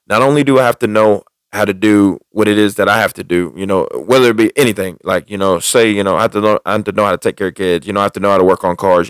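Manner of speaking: ordinary delivery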